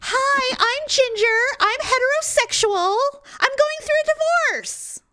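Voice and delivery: very high, chirpy, exaggerated parody; a mocking very high voice for the neighbor who keeps announcing her divorce. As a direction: squeak the introduction out like a too-eager neighbor, slow at the end. Mocking neighbor's introduction again.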